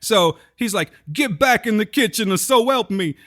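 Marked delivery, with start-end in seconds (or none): 1.1-3.2 higher mock New York tough guy; a gruff Brooklyn husband, hammed up; New York accent. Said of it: "talk like they're from New York".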